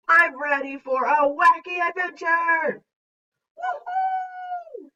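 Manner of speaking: high, loud cheer into a soaring woohoo; excited. Acted. Teacher reads exclamation point's example sentences, excited. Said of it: "we are excited in these sentences".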